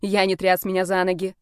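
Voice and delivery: ordinary delivery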